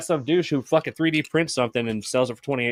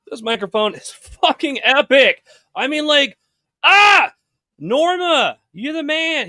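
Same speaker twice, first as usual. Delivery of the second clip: thrilled and loud; excited; 13 semitones higher, 9 dB louder, slower than usual. Real, not acted. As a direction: gush over the new mic. Raving about new microphone.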